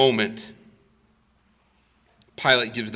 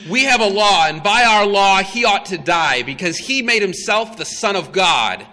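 crowd insisting; 6 semitones higher, 6 dB louder than usual. Retold or imitated. Crowd answering Pilate.

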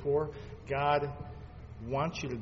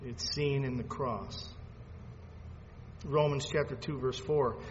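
ordinary talk, nothing marked

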